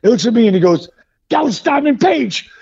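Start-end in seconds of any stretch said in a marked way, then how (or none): 1.3-2.5 high-pitched starstruck recognition; imitated excited cop. Cop recognizing a famous wrestler, imitated excitement. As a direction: gasp out the name like a fan. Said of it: "he is so excited".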